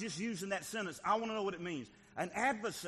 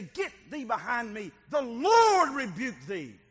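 loud, high, fervent preaching; 6 semitones higher, 9 dB louder than usual. Acted. Preacher urging the congregation to fight back, loud and fervent. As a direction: cry out to the church, don't quit.

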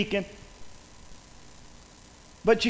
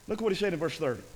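ordinary talk, nothing marked

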